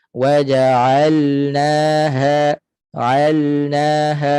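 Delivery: WAJA'ALNAHA slowly chanted with a distinct L; clear lam. Shown as a demonstration. Teacher models izhar of lam, clearly articulated. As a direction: pronounce the L fully before NA in alnaha. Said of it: "lam ini kena izhar betul-betul".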